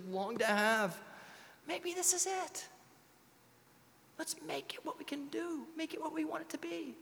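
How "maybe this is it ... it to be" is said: emotional plea. Real, not acted. Pastor pleads emotionally with congregation.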